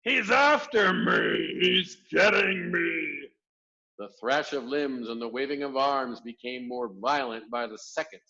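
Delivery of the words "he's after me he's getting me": high, loud, slow terrified cry; panicked screaming. A narrator's reading. Giant shrieking that Jack is chasing him. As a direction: wail in nightmare terror. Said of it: "screamed the flesh lump eater".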